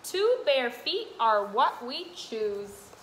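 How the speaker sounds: sing-song picture book read; reading to children. Acted. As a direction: read the rhyme brightly to little ones. Continues reading rhyming book.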